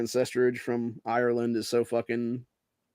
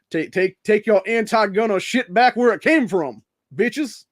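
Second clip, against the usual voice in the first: raised, higher, scornful rant; angry taunt; 9 semitones higher, 8 dB louder than usual. Pretend. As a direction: tell off absent opponents, loud and high. Scornful address to imagined anti-gunners.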